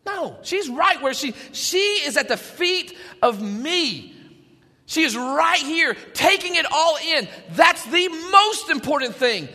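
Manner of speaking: voiced rebuke, drawn out on key words; emphatic correction. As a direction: press each point home as the teacher defending a pupil. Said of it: "Jesus flips it upside down and says".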